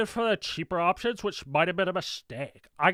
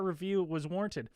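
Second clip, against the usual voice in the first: normal talking voice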